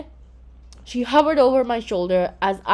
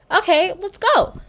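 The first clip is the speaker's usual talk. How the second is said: eager; 9 semitones higher, 5 dB louder than usual. A narrator's reading. Eager to rush to Mala.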